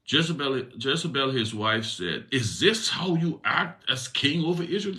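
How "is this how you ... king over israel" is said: much higher scornful queen; Jezebel's voice. Acted. Queen Jezebel scolding Ahab as king, much higher scornful voice. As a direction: scorn the weak king in a high put-on voice.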